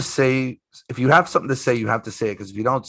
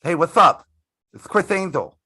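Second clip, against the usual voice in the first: imitating Chris Angel; 7 semitones higher, 4 dB louder than usual. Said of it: "I hear this voice".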